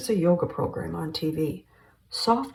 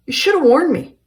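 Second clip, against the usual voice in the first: loud furious shout, pitched up high; 10 semitones higher, 12 dB louder than usual. Acted. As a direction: yell it at him, outraged.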